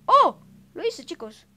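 agudo y muy fuerte, eufórico; grito de triunfo. Real, not acted. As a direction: celebra el salto a gritos. Logra el salto de parkour. (tras lograr el salto: grito eufórico)

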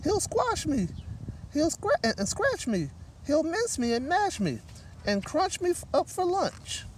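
high, panicky mouse pleading; frightened squeak. Acted. Mouse begging for help from the gorilla, high and scared. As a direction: squeak it out in terror. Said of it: "she squeaked".

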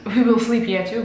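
ordinary talk

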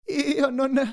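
high, winded stammer; gasped, unfinished words; panting. A narrator's reading. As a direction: start haltingly, out of breath. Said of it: "ansimò".

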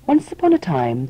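ordinary delivery, nothing marked

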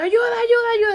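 loud, high-pitched rapid cries; reenacted shout for help. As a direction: call out after people down the street. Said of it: "me salí a gritarles".

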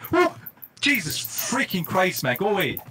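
slow, shocked outburst; jolted by a sudden fright; startled. Real, not acted. Startled by an attacker.

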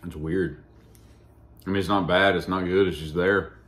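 chewing between words; eating while talking; mouth partly full. Real, not acted. Seen 2: man holds bitten pastry, jaw working between phrases after bite.

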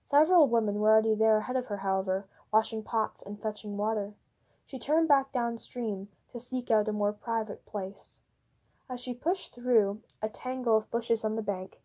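ordinary delivery

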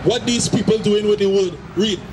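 usual speaking voice

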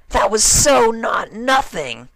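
very high, loud, slow insistent shout; excited cry. Acted. Rainbow Dash insisting the trick was amazing, high excited shout. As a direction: protest with wild enthusiasm. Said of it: "Dash cried".

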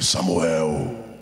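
very deep, drawn-out call of the name. Acted. God calling the boy Samuel in a deep drawn-out voice. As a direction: call the name low like a voice from above.

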